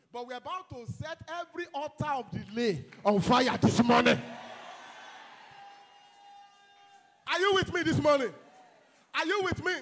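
loud, rising call to the crowd; rousing shout. Real, not acted. Preacher to congregation, a rousing shout. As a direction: stir the congregation up.

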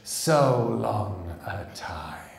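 slow, otherworldly creature voice; slow eerie monster voice, gloating; triumphant triple voice. A narrator's reading. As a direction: stretch it out like an ancient monster in triumph. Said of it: "said the triple voice of the Sleer triumphantly".

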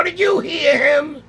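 high, drawn-out incredulous question; retold stunned squeal; amazed disbelief. Amazed question to friend in car.

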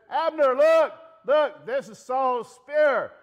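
high, loud holler across a distance; full yell, slowing into drawn-out words. Acted. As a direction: shout to make him look. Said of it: "as David is yelling out to Abner".

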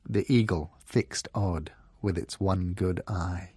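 plain reading voice

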